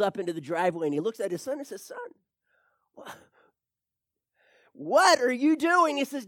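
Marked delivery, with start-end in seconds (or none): 1.8-6.0 a bemused father catching his son; bemused father questioning his son; dad's voice. Retold or imitated. Dad voiced in joke story.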